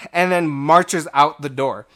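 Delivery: ordinary talk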